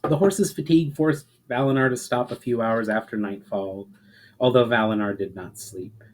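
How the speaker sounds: plain reading voice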